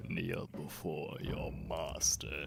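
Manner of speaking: lightly voiced domineering master; ancient lich voice. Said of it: "you nailed it".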